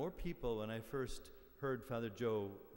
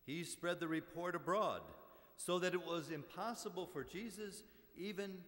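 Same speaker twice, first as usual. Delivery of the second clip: raised, measured liturgical reading; Gospel proclamation; 6 semitones higher than usual. Acted.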